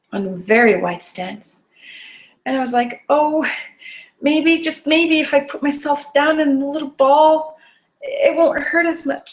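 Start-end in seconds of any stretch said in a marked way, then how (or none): 3.1-9.3 anxious hope. Retold or imitated. Frightened skier plans to curl up.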